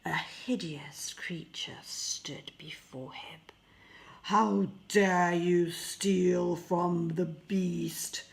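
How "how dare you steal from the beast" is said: slow, louder monstrous beast voice; beast roaring. Acted. The beast catches Pierre stealing a rose, roaring slowly. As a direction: roar it out as a furious beast. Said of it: "roared the creature".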